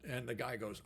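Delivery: ordinary delivery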